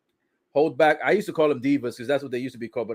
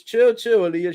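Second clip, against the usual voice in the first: mock wrestler; 7 semitones higher, 4 dB louder than usual. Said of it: "Lacey Evans says".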